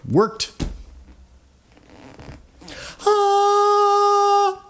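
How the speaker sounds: high excited cry of relief; triumphant whoop. Real, not acted. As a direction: whoop with sudden success. Fix finally works, triumphant.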